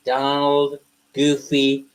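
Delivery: usual speaking voice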